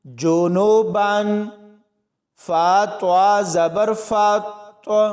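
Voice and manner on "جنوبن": slow, drawn-out recited syllables; Quranic recitation. Shown as a demonstration. Teacher reciting the joined word for students, slow chanted recitation. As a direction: chant the joined word slowly and melodically, like tajweed class. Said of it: "با الف دو زبر بن".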